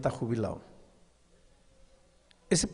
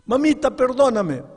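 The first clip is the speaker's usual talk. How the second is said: high, loud pleading cry; begging child; 11 semitones higher, 8 dB louder than usual. Acted. Boy caught with apples begging his mother, high crying plea. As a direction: wail it like a frightened boy. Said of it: "Él comenzó a llorar".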